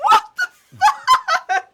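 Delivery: high, loud, slow shocked cry through laughter; shocked outburst. Retold or imitated. Mother retelling her son's stunt, shocked and laughing. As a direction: let it burst out in disbelief, laughing. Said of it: "I was like".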